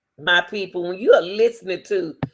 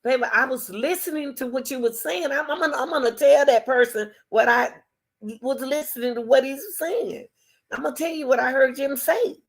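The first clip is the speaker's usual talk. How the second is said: sweet attentive partner; 7 semitones higher than usual. Shown as a demonstration. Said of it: "Tell him, say".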